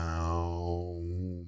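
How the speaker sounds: low, soft whooshing "shwow"; warbling ground noise. Retold or imitated. Festival-goer imitates the tripping ground, warbling whoosh. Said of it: "the ground was warbling".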